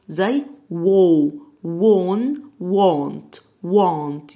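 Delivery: وو stretched long and loud, then وون, وونت; loud slow phonics demo; drawn-out w sound. Shown as a demonstration. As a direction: build the word from a long وو. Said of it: "صوت ال W هو ال و ساوند".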